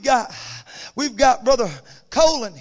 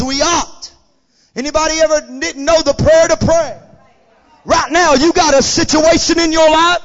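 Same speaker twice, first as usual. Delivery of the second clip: full-force preaching; 3 semitones higher, 7 dB louder than usual. Real, not acted. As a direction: drive it up to a shouted climax. Preaches to a climax.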